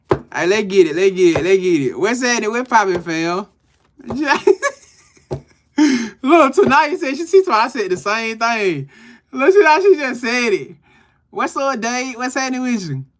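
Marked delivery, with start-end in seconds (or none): 4.0-10.8 high, louder, laughing hard. Real, not acted. Host cracking up over viewers mocking his slip. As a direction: crack up while reading chat. Laughing reading chat comments.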